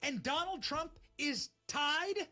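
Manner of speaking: slow reveal. Real, not acted. Host revealing Arizona tally.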